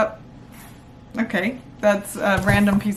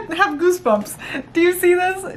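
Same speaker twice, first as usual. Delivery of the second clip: ordinary delivery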